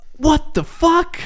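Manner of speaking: high, slow, stunned what the fuck; incredulous at being handed a book; shocked disbelief. Real, not acted. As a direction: drag it out in disbelief.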